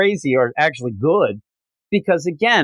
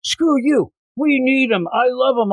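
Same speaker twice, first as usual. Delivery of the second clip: high, heated mock outburst at Alfred; 9 semitones higher than usual. Bruce snapping at Alfred to keep the kid, high mock outburst. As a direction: fire it back, pitched well up.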